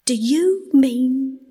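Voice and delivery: very slow, emphatic, high cry; excited disbelief. A narrator's reading. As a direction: spell out your disbelief word by word. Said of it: "cried the excited rat".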